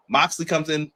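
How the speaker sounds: ordinary talk, nothing marked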